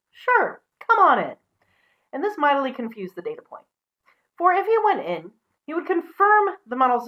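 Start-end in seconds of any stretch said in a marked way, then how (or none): 0.1-1.4 much higher, louder cheery pig; high pig inviting the wolf; cheerful pig. Acted. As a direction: welcome him breezily.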